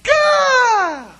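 put-on long, loud, high baby cry-out; piercing imitation of baby babble; baby shriek. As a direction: imitate a baby's squeal ringing through church. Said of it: "Like, it just echoes".

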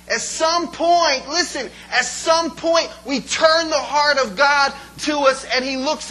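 loud, rising sermon build; preaching at full force. Real, not acted. Preacher pleading with the congregation, loud and fervent.

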